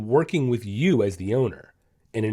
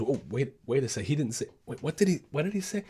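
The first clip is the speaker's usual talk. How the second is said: a hint of a puzzled dog, softer and quick at the end; confused dog voice; 7 dB quieter, faster than usual. Dog voiced by trainer, lightly puzzled and softer. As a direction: play the dog catching itself mid-sit. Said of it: "your dog goes to sit and goes".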